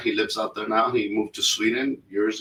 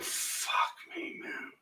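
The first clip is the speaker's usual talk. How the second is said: muttered curse; 14 dB quieter, much slower than usual. Retold or imitated. Muttered reaction to missing key.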